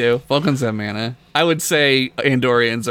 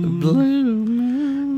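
dreamy high echo; 10 semitones higher, much slower than usual. Acted. Echo higher.

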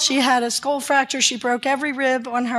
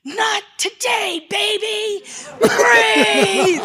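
high, drawn-out command building to a shout; will the baby back, ending in a shout; retold urgent cry; 10 semitones higher, much slower than usual. Doctor reviving a baby at a crash, retold urgent cry.

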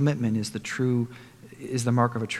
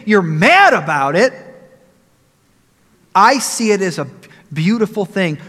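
impassioned defense; 5 semitones higher, 8 dB louder than usual. Acted. Jesus answering the complaining disciples.

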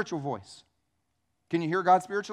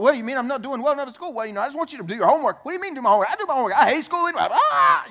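fast, loud, high bickering building to a hammed-up yell; mock escalating quarrel; 7 semitones higher, 8 dB louder, faster than usual. Preacher acts out homework fight with teenager, mock exasperated shouting. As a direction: race through a parent-teen row, climbing into a comic scream.

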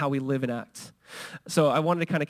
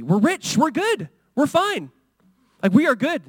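mock boasting; 9 semitones higher, 5 dB louder than usual. Laodiceans boasting of their wealth.

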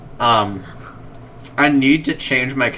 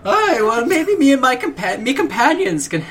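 high, wobbly put-on Irish; self-conscious Irish lilt; Irish accent; 9 semitones higher than usual. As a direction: attempt an Irish accent, stumbling as you go. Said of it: "my Irish voice".

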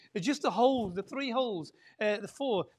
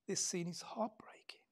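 whispered and softer; hushed; 2 semitones lower, 10 dB quieter than usual. Real, not acted. Preacher to congregation on the heartbreaking scene, whispered. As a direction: breathe it out sadly.

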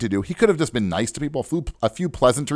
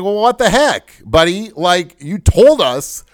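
higher, louder group protest; acting out fed-up passengers complaining; aggrieved retold protest, pitched up; indignant complaining; 7 semitones higher, 4 dB louder than usual. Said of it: "everybody started complaining".

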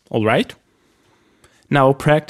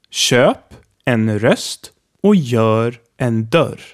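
Köp en röst och gör en dörr said even slower; slower repeat; slower than usual. Shown as a demonstration. Teacher repeating the sentence extra slowly for practice. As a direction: drag each word out for learners. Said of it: "a sentence here with all four sounds".

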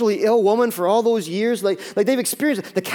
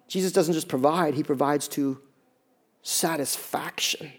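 softer and softer, slowing on satisfaction; hushed emphasis; 4 semitones lower, 6 dB quieter than usual. Real, not acted. Preacher to the congregation, dropping to a hush. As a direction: let it sink in quietly.

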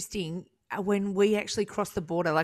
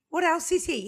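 mock-amazed cohost pushing for more; higher excited repeat; 9 semitones higher, 3 dB louder than usual. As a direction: pitch jumps up, louder and amazed. Piling up guest's credits.

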